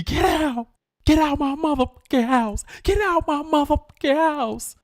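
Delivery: high, hammed-up furious yell; mama throwing him out. Mama throws her son out of the house, high mock furious yell.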